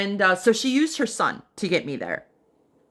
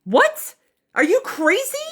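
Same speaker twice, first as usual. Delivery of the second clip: much higher, loud shocked cry; shocked disbelief; 12 semitones higher, 4 dB louder than usual. Retold or imitated. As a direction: shriek it in disbelief at her. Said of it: "And I was like".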